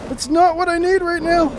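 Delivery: high panicked yelping; frantic, voice jumps way up; being chased. Real, not acted. Yao Guai chasing the player.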